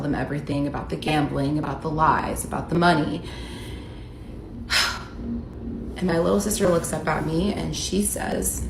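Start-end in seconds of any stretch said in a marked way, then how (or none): 3.3-5.9 long, breathy exhale of exhaustion; heavy sigh. Real, not acted. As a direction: let out a deep, weary sigh. After confessing everything to family.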